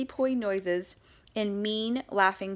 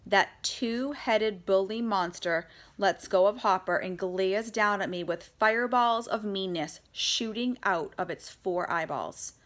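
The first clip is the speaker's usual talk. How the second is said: ordinary delivery, nothing marked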